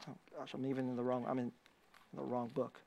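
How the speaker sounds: very quiet, low and quick to oneself; low, hushed self-correction; muttered aside. Real, not acted. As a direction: mumble a flustered aside under your breath. Flustered aside on losing his place.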